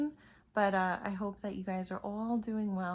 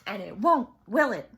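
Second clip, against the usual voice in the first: loom over the class; menacing warning; 9 dB louder than usual. A narrator's reading. Swamp warns the class.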